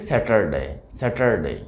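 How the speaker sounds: স্যাটারডে with a hard t, sounded r and short final "de"; learner's স্যাটারডে, r pronounced, ending cut to "de"; common mispronunciation. Shown as a demonstration. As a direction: say স্যাটারডে as "se-tar-de". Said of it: "আমরা উচ্চারণ করে থাকি".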